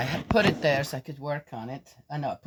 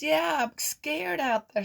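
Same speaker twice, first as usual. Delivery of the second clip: put-on much higher, slow timid little girl; scared child voice; 14 semitones higher, 4 dB louder than usual. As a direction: play a frightened little girl pleading. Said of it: "She says".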